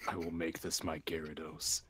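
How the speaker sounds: low, soft vow to oneself; said silently. Pretend. Player vowing privately over his new Magikarp, low and quiet. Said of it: "I say that silently".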